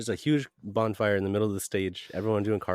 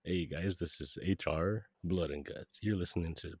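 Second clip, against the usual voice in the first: radio host voice; 3 semitones lower, 7 dB quieter than usual. Pretend. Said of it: "like a radio guy?".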